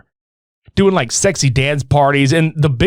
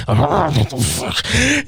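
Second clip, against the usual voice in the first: mumbling; much slower than usual. Retold or imitated. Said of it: "I pictured him just mumbling".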